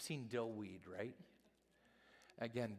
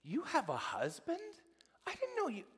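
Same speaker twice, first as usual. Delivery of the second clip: mock surprise; 6 dB louder than usual. Pastor's own startled reply retold.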